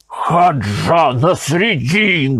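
slow, drawn-out exclamation of the name; Arslanbek exclaiming. A narrator's reading. Arslanbek realising who it is, drawn-out exclamation. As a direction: spell it out in shock. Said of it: "воскликнул Арсланбек".

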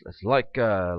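ordinary delivery

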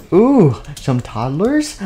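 intrigued villain, stretching the words; gleeful interest. Acted. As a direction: savor it slowly with rising glee. Dungeon master delighted by the task.

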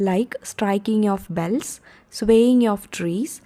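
very slow, word by word, for students to note down; slow dictation. Shown as a demonstration. Teacher reading English examples to the class slowly, word by word. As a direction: spell each phrase out slowly like dictation.

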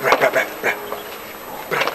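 soft unvoiced come come; whispered coaxing. Whispering bra, bra to Philippa in secret. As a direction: hush the calls, barely a breath. Calling someone in hushed voice.

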